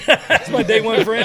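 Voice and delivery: high, slow, laughing it out. Real, not acted. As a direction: laugh through the words. Laughing echo of friend's line.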